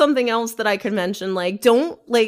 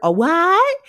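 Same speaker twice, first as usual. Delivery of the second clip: baffled ghost; 10 semitones higher, 6 dB louder than usual. Pretend. Old ghost confused by a computer.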